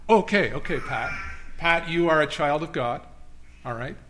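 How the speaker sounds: normal talking voice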